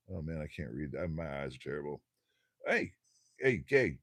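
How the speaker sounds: ordinary talk, nothing marked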